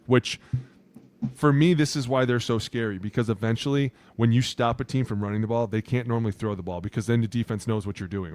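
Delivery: ordinary talk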